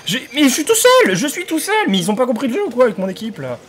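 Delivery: furious high yelling; losing it at useless teammates; raging. Real, not acted. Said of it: "désolé pour la rage".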